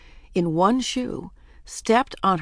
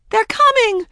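very high, loud frantic shout; terrified cry; 8 dB louder than usual. A narrator's reading. Daisy to dangling Ramona, high terrified cry. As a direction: cry out in fright. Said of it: "cried equally terrified Daisy".

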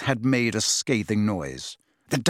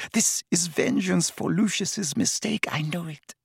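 crying whisper; 5 semitones higher, 4 dB quieter than usual. A narrator's reading. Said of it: "Narcissa began to cry in earnest".

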